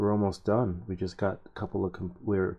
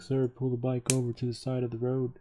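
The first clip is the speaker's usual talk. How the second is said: higher-pitched imitated cop giving an order; scooter officer's order; 6 semitones higher than usual. Officer to cyclist on the street, imitated official order. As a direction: mimic an officer ordering a rider over. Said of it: "the officer is on his scooter, and he's like".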